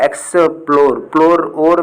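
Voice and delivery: Explore read slowly, then प्लोर echoed by itself; syllable stress demo. Shown as a demonstration. Teacher reading the word out for students, stressed syllable isolated. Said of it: "पढ़ के बताता हूँ".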